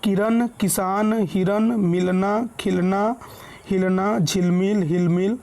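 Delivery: slow, measured word list; example word reading. Shown as a demonstration. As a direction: read each word out slowly for learners. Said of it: "उदाहरण के तौर पर देखिए जैसे". (धीरे-धीरे, एक-एक शब्द पढ़ते हुए)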